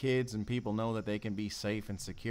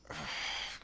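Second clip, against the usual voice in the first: heavy sigh; 7 semitones lower, 7 dB quieter than usual. Acted. Sighs before the outburst.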